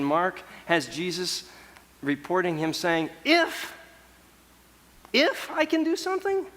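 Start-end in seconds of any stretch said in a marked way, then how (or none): 3.2-6.5 if I can, thrown back much higher in disbelief; incredulous repeat. Retold or imitated. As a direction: echo the father's doubt, pitched way up and incredulous. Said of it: "in a kind of this exasperated moment".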